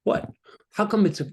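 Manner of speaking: puzzled outburst at my own tears; mid-worship, confused at weeping suddenly; surprised what. Retold or imitated. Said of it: "I'm going".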